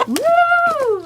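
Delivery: very high, loud whoop; excited whoop. Real, not acted. Calling out to her hens, a high loud whoop. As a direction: cheer them on. Cheering at chickens.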